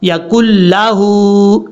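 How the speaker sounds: یکن لہو said as یکلّہو, the ن dropped and ہو drawn long, very slow; slow recited یکن لہو; joined kaf and lam. Shown as a demonstration. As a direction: run the ک straight into the ل in یکن لہو. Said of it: "لمبا کریں گے ہا کو".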